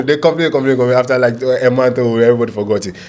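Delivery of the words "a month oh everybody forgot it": ordinary delivery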